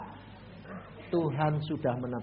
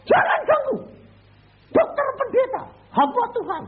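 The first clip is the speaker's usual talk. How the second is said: very high, loud call from a distance; 9 dB louder than usual. Retold or imitated. Prisoner calling to the rebels from far off, high loud call. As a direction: yell across to stop them.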